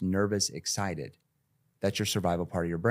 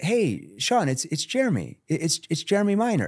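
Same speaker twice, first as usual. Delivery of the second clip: higher, easy phone greeting; raised familiar voice; 8 semitones higher than usual. Shown as a demonstration. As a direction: sound like someone they should know. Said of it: "I raised my voice intentionally".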